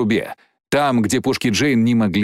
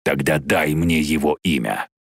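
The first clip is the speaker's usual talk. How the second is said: low, commanding creature; deep, insistent dragon; dragon's demand; 7 semitones lower than usual. Acted.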